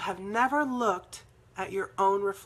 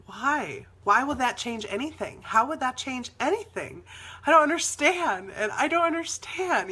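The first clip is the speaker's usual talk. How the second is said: building to high, loud protest; stuck, frustrated bafflement; 4 semitones higher, 4 dB louder than usual. Retold or imitated. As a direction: push each question harder, utterly stuck. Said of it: "I was really stuck in that".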